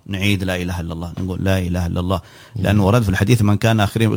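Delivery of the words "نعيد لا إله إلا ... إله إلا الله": low, soft repetition of the testimony; quiet prompting. Shown as a demonstration. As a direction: prompt softly, keeping the voice down low. Models re-prompting a dying person.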